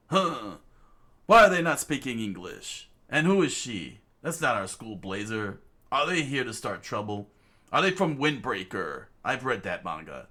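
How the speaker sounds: low and suspicious, thinking aloud; deep puzzled classmate. Acted. Rio eyeing the strange newcomers, deep puzzled voice.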